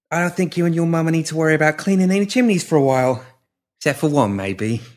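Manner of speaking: high character voice, easing down at the end; high, light-hearted reassurance; reassuring Molly. Acted. Molly's dialogue to Emily.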